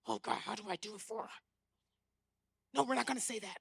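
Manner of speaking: odd hushed self-talk; muttering to himself. Retold or imitated. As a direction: mutter like a man talking to voices. Imitating date arguing with himself.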